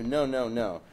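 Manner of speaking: ordinary talk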